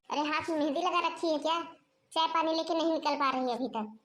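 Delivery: ordinary delivery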